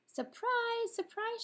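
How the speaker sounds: very high squeaky gleeful call; spring the surprise like tiny mice; shouted mouse surprise. A narrator's reading. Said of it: "shouted the mice and birds".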